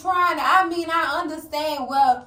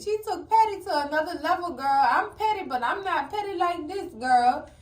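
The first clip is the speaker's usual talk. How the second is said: ordinary delivery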